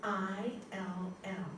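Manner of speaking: letters spelled slowly. Shown as a demonstration. Teacher spelling a word slowly for the class. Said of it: "You all see it in your mind?".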